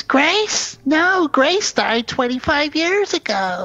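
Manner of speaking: put-on high, slow old-lady voice, puzzled; confused old aunt. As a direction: quaver it out like a muddled elderly aunt. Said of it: "And she goes".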